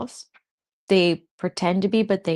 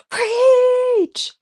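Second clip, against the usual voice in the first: loud, high cry of approval; high whoop; 7 dB louder than usual. Real, not acted. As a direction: cheer her on at the top of your voice. Cheering on a friend.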